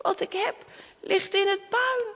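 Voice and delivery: high, hushed realisation; shocked. Acted. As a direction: say it softly, stunned by the loss. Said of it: "Ze schrok". (geschrokken, zacht en hoog)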